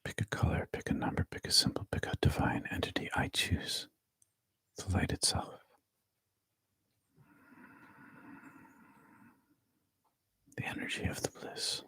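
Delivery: hushed body-scan guidance; guided meditation whisper. Acted.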